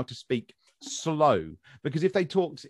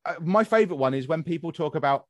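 usual speaking voice

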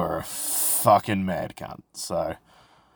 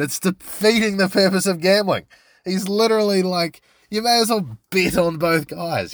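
heated rant, pitched high and climbing; over-the-top rant pitched high, really worked up; 8 semitones higher than usual. Real, not acted. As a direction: get carried away, pushing higher on every line. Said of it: "I got a little bit worked up there".

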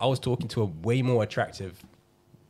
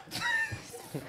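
high squeaky laugh; 7 dB quieter than usual. Real, not acted. Laughing at own joke.

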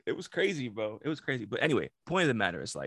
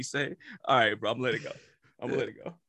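usual speaking voice

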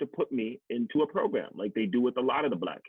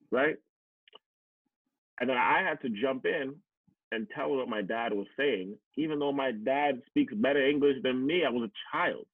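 ordinary talk, nothing marked

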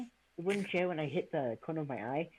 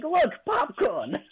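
put-on high, slow, delighted kid; excited child voice; 12 semitones higher, 5 dB louder, much slower than usual. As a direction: squeal it like an excited little kid.

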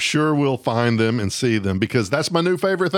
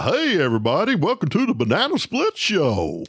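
swooping Southern show-host greeting; hammed-up Southern announcer; Southern accent; 3 semitones higher than usual. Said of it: "a lot of them have Southern accents".

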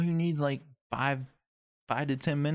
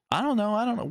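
very high-pitched, louder mock panic; imitated flustered partner; 4 dB louder, faster than usual. Partner answering his call for help, imitated high-pitched fluster. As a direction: squeal it as the helpless partner.

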